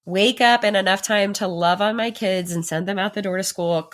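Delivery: ordinary talk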